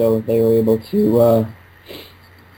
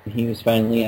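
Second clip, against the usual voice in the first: normal talking voice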